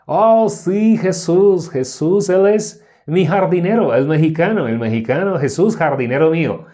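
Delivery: loud, high-pitched American gringo speaking Spanish; gringo accent. Acted. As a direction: put on a hammed-up gringo accent. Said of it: "así más o menos hablaría un gringo".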